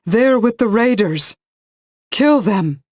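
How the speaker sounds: loud, high, slow battle cry. A narrator's reading. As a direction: yell the order to kill. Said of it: "a female voice cried".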